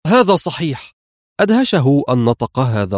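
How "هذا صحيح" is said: high, spontaneous agreement; unthinking blurt. A narrator's reading. Carlo agrees with his boss, blurting unawares. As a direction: blurt it out before thinking. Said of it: "قال دون أن ينتبه إلى كلماته".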